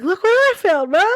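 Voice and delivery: put-on high, gleeful kid voice; excited little boy. Son waving the toy at his mom, high excited child. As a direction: shout it like a proud five-year-old.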